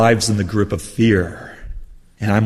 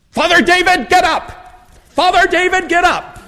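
put-on high excited New England accent shout. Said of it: "He has this wonderful New England accent".

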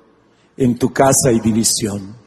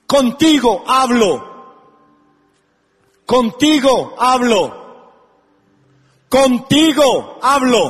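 preaching at full force; 13 semitones higher than usual. Acted. Preacher calling the congregation to receive the word, full-force preaching. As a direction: build to a thundering altar call, each phrase hammered. Preacher's climax, shouting Talita cumi.

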